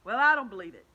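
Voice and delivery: loud, defiant outburst; centipede shouting. Acted. Centipede refusing to believe James, loud shout. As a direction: blurt it out loud and stubborn. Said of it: "shouted the centipede, raising his voice".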